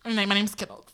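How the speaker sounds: high, put-on drag queen voice; introducing herself as a drag queen. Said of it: "That's my drag voice".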